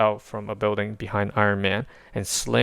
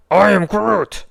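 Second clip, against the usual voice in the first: higher, louder tree creature; startled creature cry; tree creature reacts to the attack; Groot voice, alarmed; 11 semitones higher, 8 dB louder, slower than usual. A narrator's reading.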